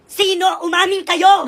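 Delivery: furious mother shouting; angry shout. Acted. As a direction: bark at the kids to own up. Mother demanding a confession.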